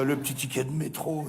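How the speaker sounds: normal talking voice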